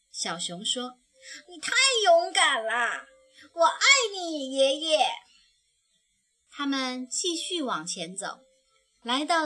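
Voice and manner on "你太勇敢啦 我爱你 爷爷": high, loud and tearful-happy; overjoyed cub. Acted. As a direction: gush with love. Cub adores grandpa. (小熊激动地对爷爷喊，高而响亮)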